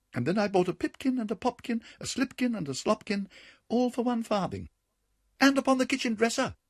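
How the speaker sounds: high, fast cheeky mouse voices; rude little mice. Acted. Rude mice taunting Simpkin through the window, high and fast. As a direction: tease the cat with a quick taunt. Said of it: "added the rude little mice".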